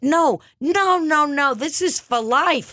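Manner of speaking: high-pitched frantic refusal; hammed-up high outburst; agitated protest. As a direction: protest dramatically, pitch jumping right up. Hammed-up protest in psychic sketch.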